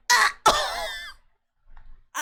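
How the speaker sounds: high shocked yelp; sudden high shriek; startled yelp. Real, not acted. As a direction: jump with a yelp. Discovering someone in the closet.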